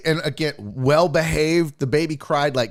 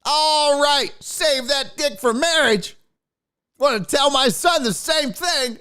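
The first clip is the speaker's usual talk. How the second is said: put-on high, hearty old man voice, way up; creepy dad voice; 11 semitones higher than usual. Dad lecturing the kid on the plane, high hearty voice. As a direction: play the chummy preachy dad. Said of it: "old man being like".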